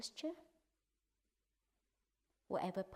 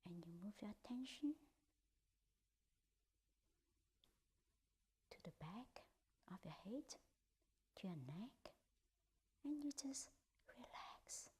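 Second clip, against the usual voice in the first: guided meditation; 2 semitones higher, 6 dB quieter than usual. Acted. Teacher guiding the group through a body scan, in a hushed whisper. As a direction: ease them through the body, part by part.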